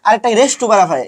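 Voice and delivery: ordinary delivery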